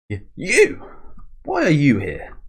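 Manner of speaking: high-pitched, acted shock; startled challenge. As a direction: blurt it out in mock alarm, pitch leaping. Acting out manga character's startled challenge.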